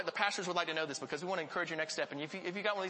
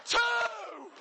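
mock counting shout. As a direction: ham up a guy shouting the count.

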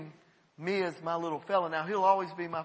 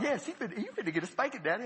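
put-on kids teasing; 4 semitones higher, 5 dB quieter, faster than usual. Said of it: "And they thought".

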